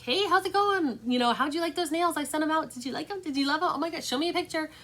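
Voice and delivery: high, chirpy, gushing check-in with a customer; bubbly customer follow-up. Retold or imitated. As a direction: sound like a sweet seller texting a happy buyer.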